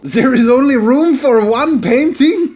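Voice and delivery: put-on loud, high French-accented outcry; mock dismay. French painter finding room for one painting, loud dismay. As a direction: cry out in theatrical horror.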